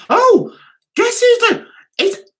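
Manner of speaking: real tears rising as she tries to say it; choked up. Real, not acted. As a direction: voice catches high and loud, then breaks. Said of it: "oh, I can't speak now".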